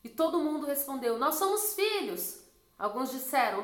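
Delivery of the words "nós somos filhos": high, slow sing-out answer; congregation's chorus. Retold or imitated. Whole church answering the pastor, imitated chorus. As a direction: imitate a crowd answering in drawn-out unison.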